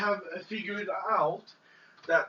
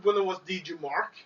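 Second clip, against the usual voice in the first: ordinary delivery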